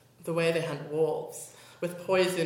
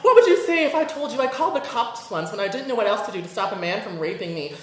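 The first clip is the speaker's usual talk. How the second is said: ordinary delivery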